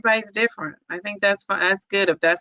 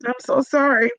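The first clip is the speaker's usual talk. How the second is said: higher voice, fighting back real tears; genuinely tearful, struggling for composure; choked up; 5 semitones higher than usual. Real, not acted. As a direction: pull yourself together between sighs. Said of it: "I gotta get it together".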